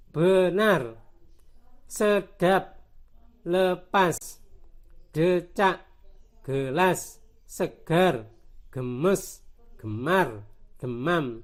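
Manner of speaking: words like "benar" with first e as "uh"; teacher's list drilling schwa as in "lepas"; schwa group. Shown as a demonstration. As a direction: read each word separately, "sedap" as sə-dap. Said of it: "Ini ternyata enak dibaca E".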